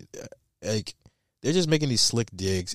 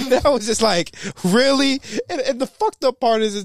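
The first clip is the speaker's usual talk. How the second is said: high loud disbelieving really; exasperated; 11 semitones higher, 6 dB louder than usual. Real, not acted. Exasperated rant.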